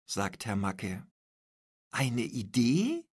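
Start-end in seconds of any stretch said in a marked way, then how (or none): 1.9-3.1 a slow, hesitant Eine Idee; unsure question. A narrator's reading. Paula unsure about Anton's idea, slow hesitant question. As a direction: ask it doubtfully, feeling your way. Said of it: "fragt Paula unsicher".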